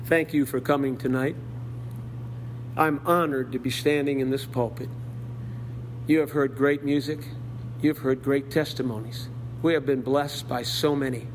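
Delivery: mimicking a tidy American church closing; American pastor sign-off. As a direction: close it out like an American preacher in a hurry. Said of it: "So I did the American thing".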